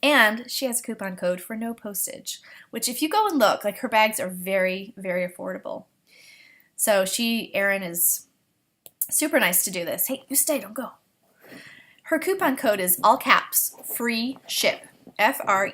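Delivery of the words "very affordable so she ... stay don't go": ordinary talk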